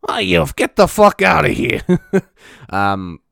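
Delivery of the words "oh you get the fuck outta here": louder, slow New York brush-off; hammed-up mock dismissal; Judge Judy impression. Judge Judy waving off the fan, New York mock. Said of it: "bad impression of Judge Judy".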